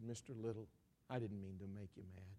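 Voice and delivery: low, softened, placating tone; soft apology. Retold or imitated. Preacher calming an angry father, softly apologetic. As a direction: back down gently after the outburst.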